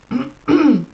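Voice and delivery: loud throat clear. Real, not acted. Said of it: "I love how my throat is clearing".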